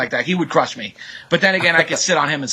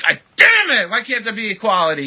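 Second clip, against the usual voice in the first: hammed-up cry of frustration, higher; mock outrage; 6 semitones higher than usual. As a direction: act out raging at injustice, half joking. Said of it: "I'm just like".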